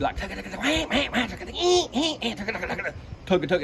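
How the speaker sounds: rolling "tugga" then high "wah" and "ee" quacks; duck feed call. Shown as a demonstration. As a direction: mimic a flock feeding. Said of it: "I'm gonna go to a hard guttural feed".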